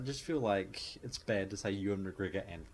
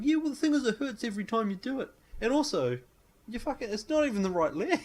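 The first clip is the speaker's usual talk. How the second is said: much higher, louder whining man; whiny complaint; 13 semitones higher, 6 dB louder than usual. Retold or imitated. Wounded man to Blondie, loud high whine. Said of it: "he's like".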